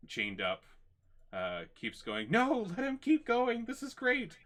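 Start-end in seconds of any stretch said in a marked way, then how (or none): 2.3-4.4 very high, loud, manic delight; gleeful maniac. Acted. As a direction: cheer on your own torment, thrilled. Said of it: "kind of laughing".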